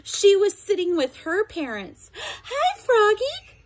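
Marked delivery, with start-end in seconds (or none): 2.4-3.6 high, slow calling hello; sweet calling girl. A narrator's reading. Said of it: "called Frogalina".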